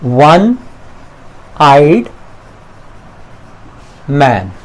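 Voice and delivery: वन, आइड, मैन said with long pauses between; dictating a phrase word by word. Shown as a demonstration. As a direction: space out वन आइड मैन one word at a time. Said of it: "एक और example".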